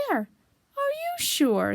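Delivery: high, very drawn-out question; slow, high astonishment. Acted. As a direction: stretch it out in disbelief. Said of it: "still more surprised".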